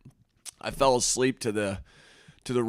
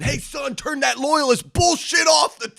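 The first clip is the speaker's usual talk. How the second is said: mock scream; 11 semitones higher, 5 dB louder than usual. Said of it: "I had to scream at my son".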